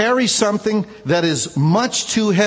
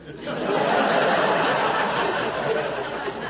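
soft breathy laugh; 4 dB quieter than usual. Real, not acted. Laughs after telling the story.

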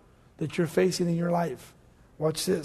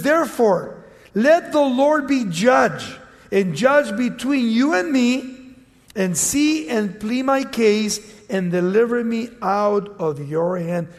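raised, emphatic Bible reading; scripture reading; 2 semitones higher, 4 dB louder than usual. Acted. Preacher reads scripture to congregation, emphatic and raised. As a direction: read the verse out to the congregation.

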